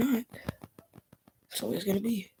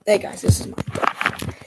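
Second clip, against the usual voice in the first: ordinary delivery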